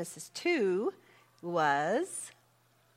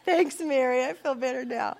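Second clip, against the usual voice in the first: laughing; 6 semitones higher, 8 dB louder than usual. Retold or imitated. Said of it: "Mary said".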